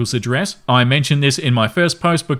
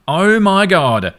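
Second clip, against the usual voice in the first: slow, drawn-out, higher disbelief; exasperated oh my god; 6 semitones higher, 3 dB louder, much slower than usual. Real, not acted. Reader reacting to the boyfriend's rude words, drawn-out exasperation. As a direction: let it drag out in stunned exasperation.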